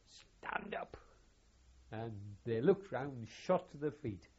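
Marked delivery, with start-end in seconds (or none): 0.0-1.0 high, sudden mock order; loud command. Colonel calls the mess officers to attention, loud command. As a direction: imitate a colonel's sharp order. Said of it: "very loudly".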